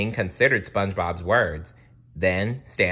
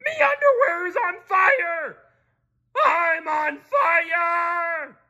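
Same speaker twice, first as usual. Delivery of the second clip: high, loud cartoon shriek; panicked yell of a crab on fire; scream; 8 dB louder, slower than usual. A narrator's reading. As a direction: scream it, stretching the last words out slowly. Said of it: "screamed Mister Krabs".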